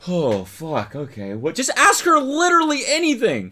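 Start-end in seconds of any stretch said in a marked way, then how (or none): 1.5-3.5 high, loud exasperated yell at the screen. Real, not acted. Streamer yells at the man on screen, exasperated. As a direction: shout your frustration at the guy. Streamer yelling at dating video.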